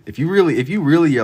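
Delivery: high, loud animated buildup; excited. Real, not acted. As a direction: get fired up. Excited buildup on horror tropes.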